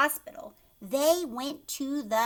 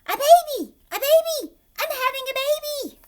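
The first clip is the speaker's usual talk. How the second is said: high shrieking yell; 12 dB louder, faster than usual. A narrator's reading. Mother waking up yelling about the baby, shrieking yell. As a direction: scream it out in a panic. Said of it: "Kristen's mother woke up and yelled".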